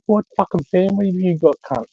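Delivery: high-pitched mock horror; retold disbelief, pitched right up; imitating horrified mate. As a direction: play the appalled army mate, voice up high. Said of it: "he was horrified".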